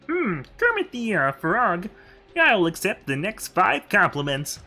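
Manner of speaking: slow, high froggy Kermit impression. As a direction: drawl it out like Kermit the Frog. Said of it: "That's a Kermit voice redeemed".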